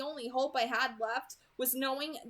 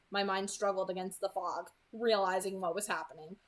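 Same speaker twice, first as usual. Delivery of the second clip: ordinary delivery, nothing marked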